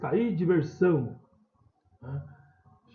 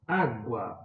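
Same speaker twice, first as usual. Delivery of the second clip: "Água" with weight on the opening Á; teacher's demo of "Água", first syllable stressed; 4 semitones lower than usual. Shown as a demonstration. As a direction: say "Água" as Á-gua for learners. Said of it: "é o a".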